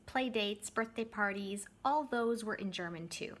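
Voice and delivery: usual speaking voice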